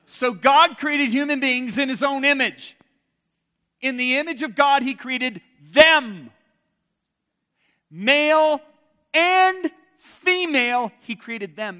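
loud, high scripture declaration; big preacher voice, drawn-out key words; full-force preaching. Real, not acted. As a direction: proclaim each phrase slowly and forcefully.

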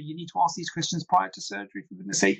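ordinary talk

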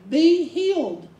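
a direct, authoritative command. Retold or imitated. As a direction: order the sickness out. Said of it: "He would speak directly to it".

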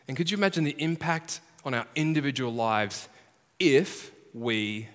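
ordinary talk

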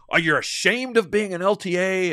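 a high, loud accusing mock challenge. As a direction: throw the question at him with scorn. Coworker confronting the speaker.

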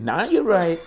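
loud, high shout; cheeky student yelling it across the class; loud holler. Retold or imitated. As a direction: heckle the teacher at full volume. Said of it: "he started saying".

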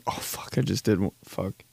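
hushed, barely voiced cursing; whispered dismay at a slip; embarrassed aside. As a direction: mutter it to yourself under the breath. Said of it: "You're like".

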